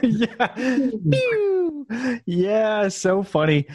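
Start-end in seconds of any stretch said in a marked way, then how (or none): 1.1-1.9 a very high "Pew!" zap; laser pew. Pretend. Host joining the laser joke, very high pew. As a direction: squeak out a toy laser shot.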